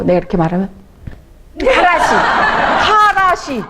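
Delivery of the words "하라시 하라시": imitating elder's nagging. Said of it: "하라시는 또 배웠어요".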